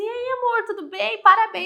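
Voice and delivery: high-pitched imitation of an upbeat greeting; cheery congratulating. Lucas phoning his love to congratulate her, cheery.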